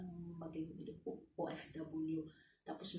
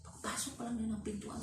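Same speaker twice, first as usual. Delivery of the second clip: usual speaking voice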